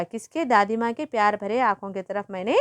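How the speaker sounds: usual speaking voice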